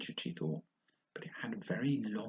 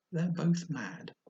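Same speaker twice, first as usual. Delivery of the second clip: slow, drawn-out and knowing; sly cat; 7 semitones higher, much slower than usual. Acted. The cat telling Alice everyone is mad, slow and sly. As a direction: savour the word mad slowly.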